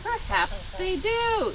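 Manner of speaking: high, slow, drawn-out cow voice. A narrator's reading. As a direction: muse it like a slow old cow. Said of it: "said Butterfat Cow".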